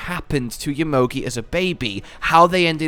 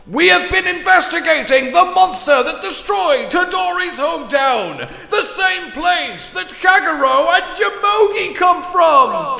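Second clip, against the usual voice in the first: dramatic emphasis; 13 semitones higher than usual. Shown as a demonstration. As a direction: announce it like a big reveal. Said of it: "Let me repeat that once more time for emphasis".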